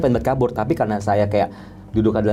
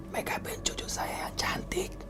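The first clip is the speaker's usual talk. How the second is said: slow, drawn-out whisper; whispered ghostly request; 15 dB quieter than usual. Retold or imitated. Possessed bride asks the makeup artist, slow eerie whisper. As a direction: whisper it slowly, like a possessing spirit.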